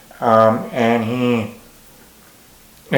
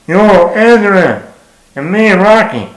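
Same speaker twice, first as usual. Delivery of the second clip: slow, loud and pitched way up, a clumsy Rocky call; bad Stallone impression; 7 semitones higher, 6 dB louder than usual. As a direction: belt it out like a hammy Rocky calling for Adrian. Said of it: "the horrible Stallone impression".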